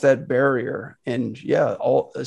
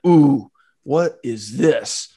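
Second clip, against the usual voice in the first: slow, drawn-out curious ooh; intrigued gamer; 4 dB louder, much slower than usual. Pretend. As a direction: sound hooked by something new. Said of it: "You wanna be like".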